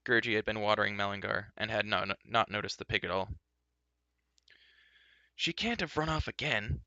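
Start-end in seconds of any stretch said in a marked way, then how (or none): 5.3-6.8 higher, anxious cry. A narrator's reading. Terran crying out, worried about the pig. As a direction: cry out in worry. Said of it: "Terran cried".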